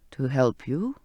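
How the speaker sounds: put-on low old man voice; a deep, kind old sailor. Zachariah's voice.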